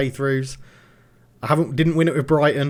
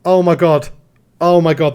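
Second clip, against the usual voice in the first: tense excitement; 3 semitones higher, 7 dB louder than usual. Real, not acted. Thirty seconds left, big chance.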